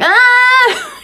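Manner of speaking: high, held imitation toddler scream; mock scream. Mom imitating twins who can't say their wants, mock scream. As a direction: squeal like a frustrated little one, not full volume. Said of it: "And they just scream".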